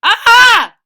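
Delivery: very high, very loud startled scream; sudden shriek of terror. Acted. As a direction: scream in pure fright. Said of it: "screamed Wilson".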